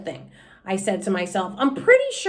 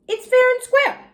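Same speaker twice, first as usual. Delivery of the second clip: much higher, sure of herself; high insistent; 4 dB louder than usual. Acted. Junie B. convinces herself to keep the pen, high and certain. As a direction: squeak it out as final proof.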